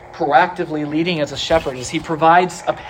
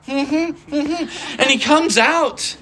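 laughing; 6 semitones higher, much slower than usual. Retold or imitated. Lazarus hopping out like mummy.

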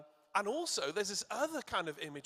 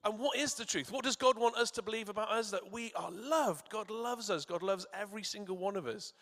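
louder, higher, building proclamation of love; fervent preaching; 5 semitones higher, 3 dB louder than usual. Acted. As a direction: proclaim it to the whole church. Preaching with rising force.

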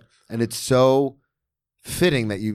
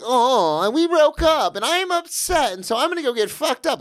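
much higher, hammed-up self-pity; mocking whine; 12 semitones higher than usual. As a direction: parody a melodramatic addict. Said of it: "Like, that's not real".

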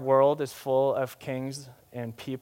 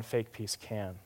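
dropped low; 5 semitones lower, 10 dB quieter, slower than usual. Acted. Contrasting real and fake peace.

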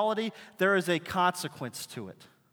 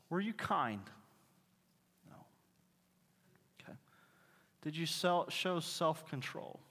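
hushed questioning; 11 dB quieter than usual. Retold or imitated. Father questions kids at the board.

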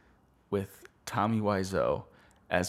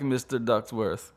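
ordinary talk, nothing marked

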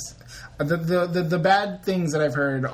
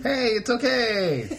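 fake cheerful; 9 semitones higher, slower than usual. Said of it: "Did that sound genuine or fake?".

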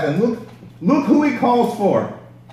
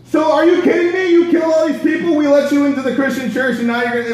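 much higher, fast, laughing mock rant; hammed-up outrage; 5 semitones higher, faster than usual. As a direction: overplay Barnabas losing it. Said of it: "I'm imagining the discussion".

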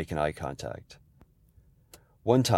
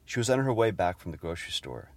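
usual speaking voice

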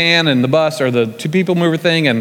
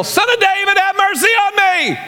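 imitated desperate yell. Blind man calling to Jesus outside Jericho, yelling. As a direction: yell it out, stretching the plea. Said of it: "he hears somebody yell".

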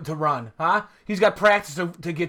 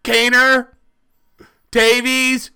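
loud, high ranting about the team; hammed-up outrage, louder and higher; impassioned rant; 7 semitones higher, 7 dB louder, slower than usual.

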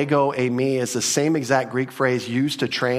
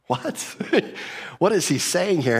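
breathy gasp of shock, then higher; a startled hearer who can't believe it; stunned disbelief; 6 semitones higher than usual. Retold or imitated. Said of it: "must have just been like".

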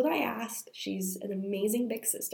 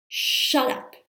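a hoarse, strained "Shut up" pushed out louder; angry yell; 4 semitones higher, 5 dB louder than usual. Retold or imitated. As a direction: snap it at her full of built-up anger. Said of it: "I lost it".

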